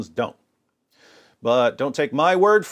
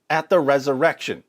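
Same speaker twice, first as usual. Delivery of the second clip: normal talking voice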